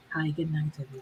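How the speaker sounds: low, soft sultry greeting; sexy voice. Pretend. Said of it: "let me put on my sexy voice".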